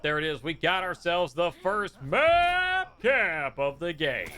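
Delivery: slow, drawn-out hype call; excited caster call. Real, not acted. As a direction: stretch it out like a big play. Caster calls the first map cap.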